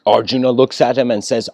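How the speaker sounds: normal talking voice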